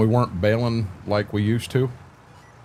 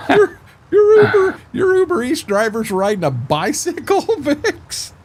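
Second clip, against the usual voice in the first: high-pitched, incredulous laughing question; squealing with laughter while asking; cracking up; 6 dB louder than usual. Real, not acted. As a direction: break into real laughter mid-sentence. Cracking up at bicycle delivery.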